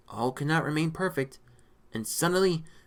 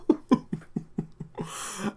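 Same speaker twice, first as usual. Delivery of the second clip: real, mostly voiceless laugh; breathless laughter; 7 semitones higher, 3 dB quieter than usual. Real, not acted. Reader cracking up over the story, breathless laughter. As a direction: wheeze with laughter.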